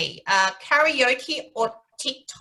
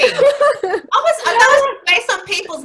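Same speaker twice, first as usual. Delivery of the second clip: laughing; 14 semitones higher, 10 dB louder than usual. Real, not acted. Laughing explanation after protest.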